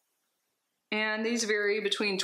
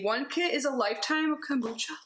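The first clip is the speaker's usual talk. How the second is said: ordinary talk, nothing marked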